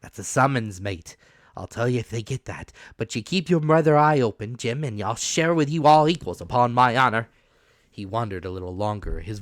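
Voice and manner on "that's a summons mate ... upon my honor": put-on rough, loud old pirate captain; hoarse pirate captain voice; old sea captain. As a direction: growl it out like a dying buccaneer. Captain's warning to Jim.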